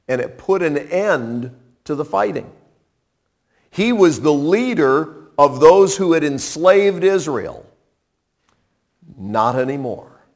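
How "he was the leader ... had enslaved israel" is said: ordinary talk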